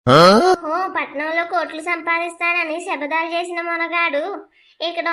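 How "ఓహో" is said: loud sneering oho; mocking oho. Rival mocking Shekar in the hospital corridor, loud sneer. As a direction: jeer at him loudly.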